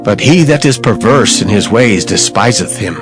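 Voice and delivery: usual speaking voice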